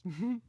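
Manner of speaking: a girlish, mocking titter; high giggle. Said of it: "The soul shepherds giggle in unison".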